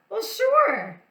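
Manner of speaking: high, loud cheerful sure; bright agreement. Acted. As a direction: answer with big enthusiasm. Teacher brightly agrees with hen.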